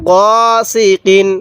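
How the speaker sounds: গসিকিন read very slowly, syllable by syllable; slow recitation. Shown as a demonstration. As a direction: draw out গসিকিন carefully. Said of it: "আরেকবার বলুন".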